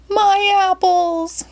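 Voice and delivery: put-on very high, slow old-lady wail; granny dismayed at her apples; Granny cries. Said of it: "cried Granny Smith".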